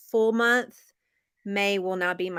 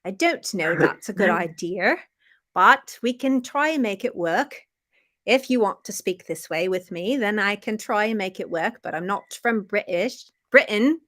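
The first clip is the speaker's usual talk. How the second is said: amateur imitation British accent; hammed-up British storybook voice. Shown as a demonstration. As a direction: put on a playful British accent. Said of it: "I try and do the British accent".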